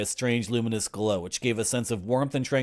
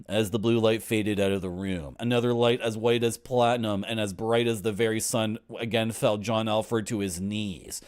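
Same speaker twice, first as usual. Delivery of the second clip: ordinary talk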